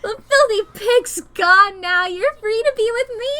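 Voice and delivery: a touch high and loud, manic glee; crazed elation. Acted. Crazed girl invites the boy to run away together, manic glee. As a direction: gush at him like it's a love confession.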